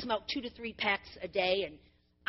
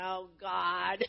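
laughing groan; 5 semitones lower, 3 dB quieter than usual. Retold or imitated. Amused groan at daughter-in-law.

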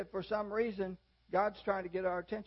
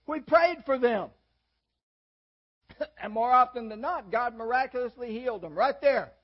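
louder, rising preacher's fervor; fervent preaching; 3 semitones higher, 7 dB louder than usual. Acted. Preacher telling the congregation how his family prayed, fervently.

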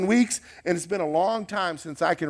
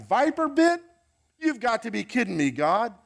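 exasperated outburst; 9 semitones higher than usual. Pretend. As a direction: rant at God, at the end of patience. Paul's rant builds to outburst.

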